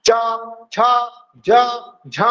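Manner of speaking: च, छ, ज, झ each voiced separately with an audible rub of friction; teacher spells out च, छ, ज, झ with clear friction; consonants with friction. Shown as a demonstration. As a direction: pause between च, छ, ज and झ and let the friction be heard on each. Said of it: "तो आपको संघर्ष दिख रहा है".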